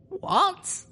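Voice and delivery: very high, baffled yelp of a question; high-pitched astonishment; startled question. Acted. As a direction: react in sheer bewilderment, voice shooting up. Startled reaction to Spike's outburst.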